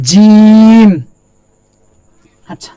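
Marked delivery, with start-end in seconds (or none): 0.1-0.9 জিম called out high and loud, drawn out; clear recitation. Shown as a demonstration. Teacher reciting জিম loudly for students to repeat. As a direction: project জিম like a Quran recitation. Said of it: "তারা বরাবর উপরে তালুর সঙ্গে লাগাইতে হবে".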